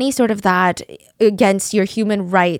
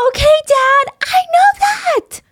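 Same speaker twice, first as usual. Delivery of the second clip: imitating a shrieking, much higher and louder outburst; mock wail, pitched way up and loud; screaming and crying; 6 dB louder than usual. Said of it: "To which Jamie starts screaming".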